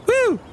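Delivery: very high-pitched "woo"; celebratory whoop. Real, not acted. Host plugging the show to listeners, high whoop. Cheering for the host's own show.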